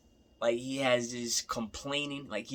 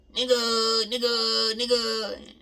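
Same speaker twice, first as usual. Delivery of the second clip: much higher, louder shouted call, hammed up; mock hype yell; 10 semitones higher, 6 dB louder, slower than usual. Imitating 6ix9ine performing for a crowd, in a mock high yell. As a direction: bark it out like a rapper performing for a crowd. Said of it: "He could get out".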